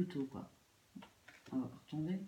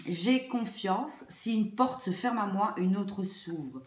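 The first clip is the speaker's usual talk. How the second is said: louder, deliberate affirmation read; 4 dB louder than usual. Acted. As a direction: recite it like a mantra. Reads card affirmation aloud.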